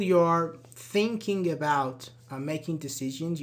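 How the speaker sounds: ordinary talk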